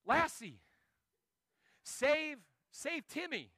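high, drawn-out mock plea for rescue; mock distress call. Owner fallen through ice to dog, mock distress. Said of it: "if I ever fall through the ice".

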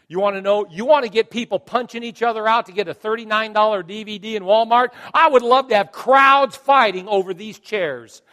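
loud, high, driving sermon delivery; fired-up preaching. Real, not acted. Pastor wishing for crowds at church, fired-up preaching. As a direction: preach it at full force.

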